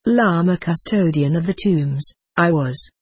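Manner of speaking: usual speaking voice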